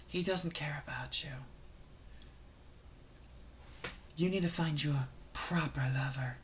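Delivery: very soft, low near-whisper; hushed, low advice to the ladies; mock microphone confidential. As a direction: murmur intimately into a pretend microphone. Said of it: "Let's imagine this was a microphone".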